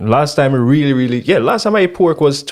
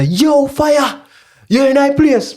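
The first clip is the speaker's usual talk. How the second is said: high, bright Rasta greeting; Rasta man voice; 14 semitones higher, much slower than usual. Retold or imitated. Rasta man hailing him in the garden, high greeting. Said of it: "And the man see me and him say".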